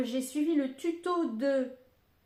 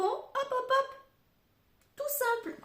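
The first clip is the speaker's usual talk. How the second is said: playful; 13 semitones higher than usual. Real, not acted. Playful stamping sounds.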